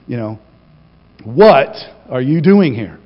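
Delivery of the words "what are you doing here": slow, louder exasperated question; fed up, drawn out and pushed. Shown as a demonstration. God to Jonah, slow exasperated demand. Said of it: "a little bit of an exasperation".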